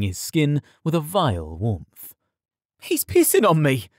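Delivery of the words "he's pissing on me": high, appalled outcry; disgusted yell. A narrator's reading. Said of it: "the stable boy yelled".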